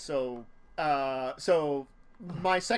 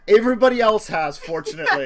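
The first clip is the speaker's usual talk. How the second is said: laughing; 4 semitones higher, 11 dB louder than usual. Real, not acted. Joke while laughing.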